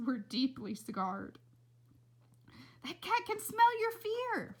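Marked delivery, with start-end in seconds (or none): 2.8-4.6 high, slow alarmed cry; shouting. A narrator's reading. Flora shouting at her father as she runs, high and slow. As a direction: call it out over your shoulder. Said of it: "Flora shouted as she ran".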